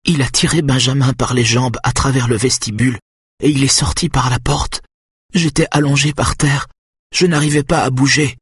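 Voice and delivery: high, pleading woman's voice; despairing account. A narrator's reading. Wife tells husband of abduction, high and despairing. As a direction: recount it high, in anguish.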